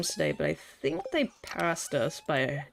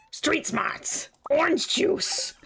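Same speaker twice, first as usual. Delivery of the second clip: high, punched catchphrases; hammed-up comedy shout; 8 semitones higher, much slower than usual. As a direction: call them out high, stretching the last.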